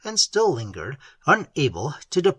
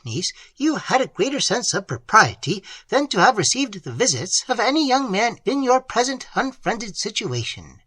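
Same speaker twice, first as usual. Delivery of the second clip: haughty scolding aunt; 6 semitones higher than usual. A narrator's reading. Aunt lecturing niece on propriety, higher and scolding. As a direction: scold the girl as a self-important guardian. Narrator voices Madame Cheron scolding.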